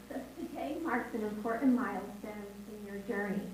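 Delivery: ordinary delivery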